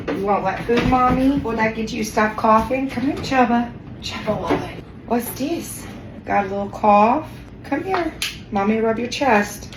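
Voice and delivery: high, doting pet voice. Real, not acted. Owner soothing coughing pet, baby talk. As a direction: coax a pet sweetly, way up high.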